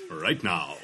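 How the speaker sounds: low vintage announcer sign-off; old radio voice. Acted. Co-host capping the fake gravy ad, old radio voice. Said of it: "that's my sort of warm-up voice".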